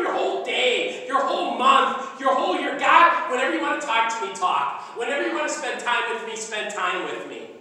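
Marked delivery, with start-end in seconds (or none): 2.8-7.5 earnest prayer. Acted. Prayer spoken as example.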